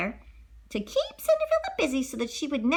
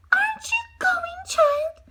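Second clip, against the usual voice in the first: high fake concern; 3 dB louder, slower than usual. Stepmother mocking.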